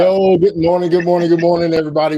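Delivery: big energetic morning welcome; loud greeting. Real, not acted. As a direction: call out the hello to everyone. Hosts greeting listeners on air.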